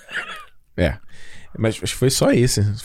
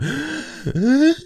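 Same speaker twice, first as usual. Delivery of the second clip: excited gasp; 12 semitones higher than usual. Retold or imitated. Excited gasp before the movies.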